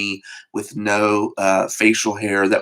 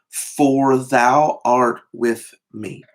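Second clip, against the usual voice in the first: very slow, every word laid down; slow scripture reading; much slower than usual. Shown as a demonstration. Preacher dwelling on the psalm's comfort, very slowly. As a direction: read it word by word, unhurried.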